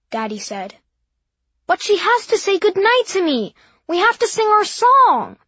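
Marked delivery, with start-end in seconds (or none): 1.7-5.4 put-on high, loud upset child; loud child protest. A narrator's reading. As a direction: protest shrilly.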